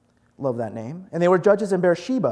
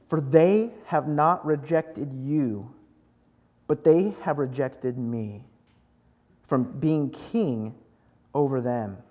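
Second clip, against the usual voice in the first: slow, low, heavy-hearted reading; sombre scripture reading; 6 semitones lower, 4 dB quieter, slower than usual. A narrator's reading. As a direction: read it gravely, phrase by phrase, lingering. Reads God's sad answer.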